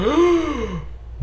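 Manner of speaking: high mock-shocked gasp; a big theatrical gasp. Real, not acted. Reacting to "never seen it.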